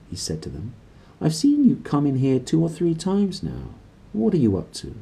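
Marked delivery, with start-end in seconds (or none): 1.2-4.9 put-on higher, curious old priest voice; genuinely interested priest. A narrator's reading. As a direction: ask it with real interest, not accusing. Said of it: "He sounded as if he were genuinely interested".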